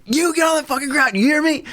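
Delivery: high, forceful mock cop shout; retold cop yell. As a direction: yell it like a cop who has his gun out. Cop repeats shouted order.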